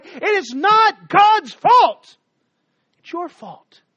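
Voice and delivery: slow, high, loud proclamation; climactic shout. Acted. Preacher to the congregation, slow climactic shout. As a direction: drag it out at full force.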